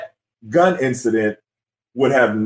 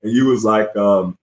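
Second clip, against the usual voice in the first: usual speaking voice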